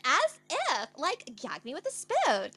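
high-pitched, hammed-up Valley Girl; Valley Girl accent. Shown as a demonstration. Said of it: "I love doing the Valley Girl accent".

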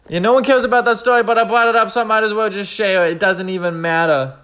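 defensive outburst. Real, not acted. Defensive outburst about own story.

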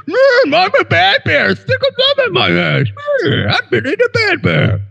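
very high, squeaky pull-string toy voice; hammed-up falsetto teddy bear; talking toy bear. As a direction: squeal it like a naughty stuffed bear. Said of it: "You pull his little cord and goes".

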